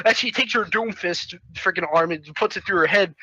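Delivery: plain reading voice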